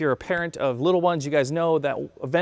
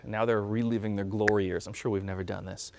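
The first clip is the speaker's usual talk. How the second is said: ordinary delivery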